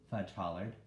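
plain reading voice

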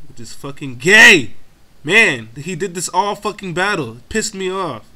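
irritated outburst with loud punched words; angry rant. Real, not acted. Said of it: "Sorry, I'm done ranting".

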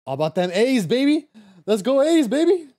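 loud, high ballpark cheer; hyped sports fan chant; team cheer. Real, not acted. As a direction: whoop for the A's like in the stands. Streamer cheering for her team.

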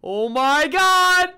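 high, loud, drawn-out cry; slow wail of dread; panicked wail. Real, not acted. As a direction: stretch it out in alarm. Clown is upon him.

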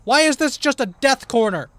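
high, loud building complaint; frustrated yell. Real, not acted. Player stuck in a death corner, loud frustrated yell. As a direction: holler in exasperation at being trapped. Trapped in a dead-end corner.

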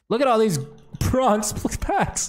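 high, laughing at bad luck; laughing disbelief. Real, not acted. Laughing at bronze packs.

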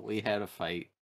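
normal talking voice

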